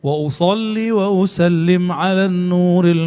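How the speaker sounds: slow, chanted reading of the Arabic; devotional recitation. Acted. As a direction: recite the salawat slowly and reverently. Recites Arabic salawat text.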